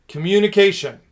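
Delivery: loud, high announcement; announcing. Real, not acted. Speaker announcing the fifth driver, loud and emphatic. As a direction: proclaim the topic. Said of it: "announces fifth driver".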